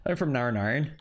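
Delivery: Northern Irish "Narn Iron", syllables run together; Northern Irish accent. Shown as a demonstration. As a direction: imitate a Northern Irish character, lilting upward. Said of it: "all the Northern Irish characters".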